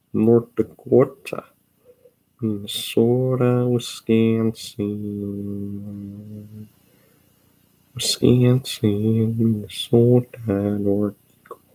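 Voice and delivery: slow, drawn-out North Central place names; hammed-up Upper Midwest accent, stretched for a meme; milk every vowel in a joke Midwest accent; exaggerated North Central accent.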